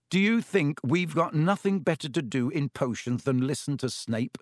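plain reading voice

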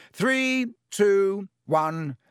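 high, loud countdown call; shouted countdown. A narrator's reading. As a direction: call the start to the hall. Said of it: "he shouted".

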